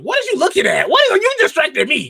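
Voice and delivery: high, loud laughing protest; loud high complaint. Real, not acted. Host laughing and shouting at distracting co-host, loud and high. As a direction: call her out for distracting you, loud and high.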